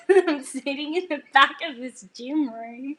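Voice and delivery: high, loud, laughing through the words; overcome, voice lifted into laughter; teary laugh. Retold or imitated. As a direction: laugh helplessly as you talk, close to tears. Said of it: "And I'm starting to tear".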